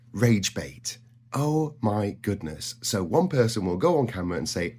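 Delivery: ordinary talk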